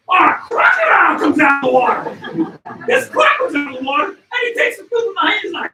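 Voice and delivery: loud, high, breathless excitement; excited shout. Real, not acted. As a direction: shout the big reveal. Crocodile emerges, story climax.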